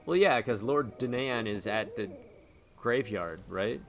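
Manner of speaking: ordinary talk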